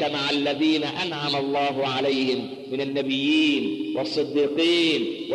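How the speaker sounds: drawn-out solemn Quran recitation; slow recitation. Acted. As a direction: recite it slowly, lingering on each word.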